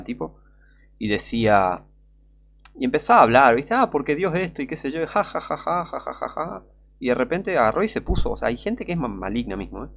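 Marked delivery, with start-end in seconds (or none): 3.7-6.6 mocking imitation with a fake ha-ha laugh; imitated scoffing laugh; mock laughter. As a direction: mimic a scoffing comedian, laugh tailing off softer. Mimics a comedian joking about God.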